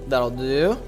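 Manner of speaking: ordinary talk, nothing marked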